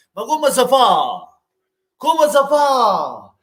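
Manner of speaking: high, slow, drawn-out how-is-this-possible; exasperated disbelief. Real, not acted. Fan lamenting the goal to his viewers, slow exasperation. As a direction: stretch it out in despair.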